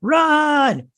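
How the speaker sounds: high, loud alien shriek; weird screeched command. Retold or imitated. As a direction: screech it like a body snatcher. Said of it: "do their weird screeching".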